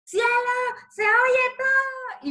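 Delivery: high, loud, slow mock yell from across the house; hammed-up husband shouting the bad news; imitating husband's yell. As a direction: call out the alarm like the husband running in. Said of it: "gritando desde allá".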